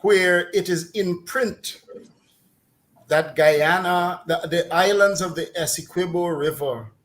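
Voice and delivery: ordinary talk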